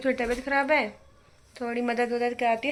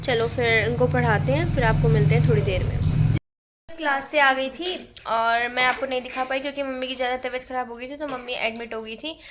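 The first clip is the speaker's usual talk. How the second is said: ordinary delivery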